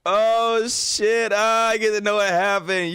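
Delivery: loud, high, drawn-out excitement; thrilled outburst. Real, not acted. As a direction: cry out, delighted at the discovery. Delighted to find a part two.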